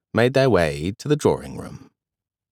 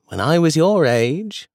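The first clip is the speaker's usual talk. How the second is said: lightly raised, amused granny voice; a hint of grandmother Elizabeth; 7 semitones higher than usual. A narrator's reading. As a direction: play the fond grandmother lightly. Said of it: "she said".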